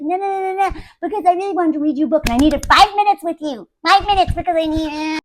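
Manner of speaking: fast, babbling excuse; mock pleading. Husband begging for five minutes with wife, rushed mock pleading. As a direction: rush it out like a needy husband. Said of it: "And he's like".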